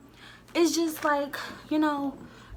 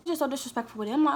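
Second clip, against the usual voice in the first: ordinary talk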